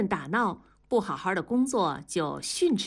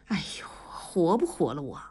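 tired whispered sigh. Acted. Wife exhausted, complaining to herself.

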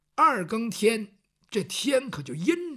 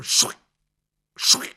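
lightning swish; 8 dB louder than usual. Acted. Lightning flashes in the storm.

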